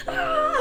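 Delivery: high-pitched acted gasp of excitement; shaking starstruck squeal. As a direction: reenact a thin, very high squeal. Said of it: "I was just, like, shaking".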